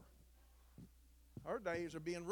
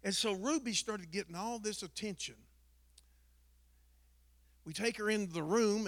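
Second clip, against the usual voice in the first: normal talking voice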